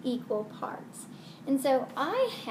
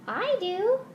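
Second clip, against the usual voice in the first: high puppet voice; 8 semitones higher than usual. Acted. Puppet answers.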